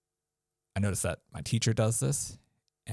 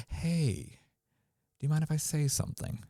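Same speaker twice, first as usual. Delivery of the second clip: polite interrupter; 8 semitones higher than usual. Shown as a demonstration. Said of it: "You're just like".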